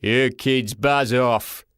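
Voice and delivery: great booming porter voice, slow; mimicked gruff official; deep man's voice. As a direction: boom it out slowly like a gruff man. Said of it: "spoke in a deep man's voice".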